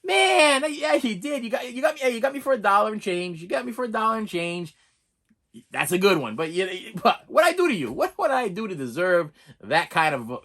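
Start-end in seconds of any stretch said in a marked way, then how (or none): 0.0-4.7 rueful mock whine. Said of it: "I was like".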